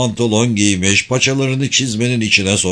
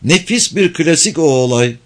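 lightly put-on, raised excited voice; exclaiming; 5 semitones higher than usual. A narrator's reading. Girl refuses to forget the episode, mildly exclaiming. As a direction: exclaim it with relish, pitch climbing. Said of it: "diye bağırdı".

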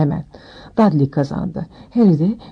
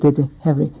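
ordinary delivery, nothing marked

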